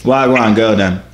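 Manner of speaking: low, loud Jamaican patois greeting. Acted. Man approaches a new woman, Jamaican patois. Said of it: "the Jamaican version of me".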